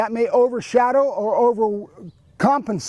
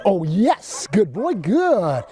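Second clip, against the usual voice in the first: swooping, driving praise for the dog; excited praise; 2 semitones lower, much slower than usual. Shown as a demonstration. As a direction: rev the dog up with encouragement. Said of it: "a little excitement and drive".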